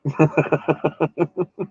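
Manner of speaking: high, rapid staccato giggle; long, rising string of high giggles. Real, not acted. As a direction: giggle in quick bursts that climb in pitch. Laughs after the accent teasing.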